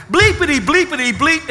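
lightly hammed-up cursing, bleeped out; mock cursing. Preacher mimics how the congregation used to curse, mock cursing. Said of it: "used to just talk like this".

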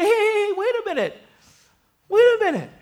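high-pitched comic hailing; calling out, puzzled. Acted. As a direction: stop a passer-by in a high acted voice. Bystander calls out to man on bike.

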